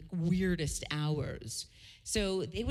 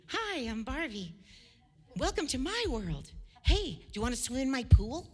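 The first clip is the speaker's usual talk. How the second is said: put-on higher-pitched teenage doll voice; Barbie; 5 semitones higher than usual. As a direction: greet them as a talking doll, pitched up. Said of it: "imagine me at nineteen".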